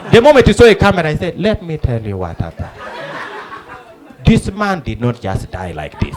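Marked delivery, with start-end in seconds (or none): 1.4-6.2 low solemn testimony; solemn eyewitness. Retold or imitated. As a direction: deliver it low, like a grave revelation. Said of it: "this man thought they were discussing".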